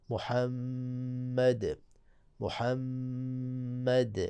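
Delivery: محمد very slow, the final د bounced clearly; tajweed demo of qalqala kubra; qalqala on dal. Shown as a demonstration. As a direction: stop on محمد with an echoing د. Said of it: "دال کو آپ نے ظاہر کرنا ہے".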